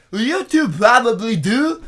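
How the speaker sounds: high, loud put-on voice, a bit slow; imitating the guy. Correcting the misheard line in the stranger's voice. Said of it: "he goes".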